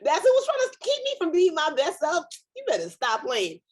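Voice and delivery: high, laughing "that's it?" of mock disbelief; scoffing disbelief. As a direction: laugh it off, unimpressed by the curtain. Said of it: "you're like".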